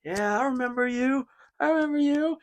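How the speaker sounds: high mocking wrestler voice; The Rock impression. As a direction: taunt it like a cocky wrestler. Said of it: "he was like".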